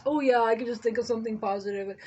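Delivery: mocking, dismissive quote; sarcastic voice. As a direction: scoff at the idea. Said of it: "I know I have a sarcastic voice".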